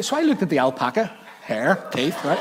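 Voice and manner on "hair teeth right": normal talking voice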